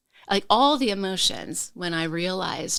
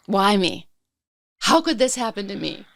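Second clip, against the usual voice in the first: higher, incredulous self-pitying plea; victim lament; 4 semitones higher, 4 dB louder than usual. Retold or imitated. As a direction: relive the disbelief, pushed up in pitch. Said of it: "moving into the victim mentality".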